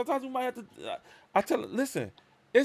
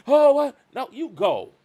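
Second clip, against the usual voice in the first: mocking the girls; 8 semitones higher, 9 dB louder, slower than usual. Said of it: "talk to you like a gir".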